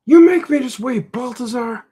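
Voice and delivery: high, loud accusing yell; critic yelling. Acted. The critic character blames Baltazar, high loud yell. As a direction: throw the blame at him in a high shout. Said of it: "he has to yell at me all the time".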